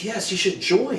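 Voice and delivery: high, insistent urging; excited friend. Retold or imitated. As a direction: imitate an eager friend talking someone into it. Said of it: "she got excited".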